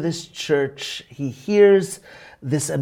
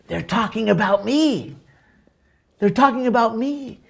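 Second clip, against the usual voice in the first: a voice jumping high, excited and amazed; as if shocked that he is noticed; 8 semitones higher than usual. Retold or imitated. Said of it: "Ramsey looks at her and says".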